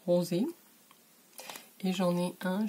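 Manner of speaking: ordinary delivery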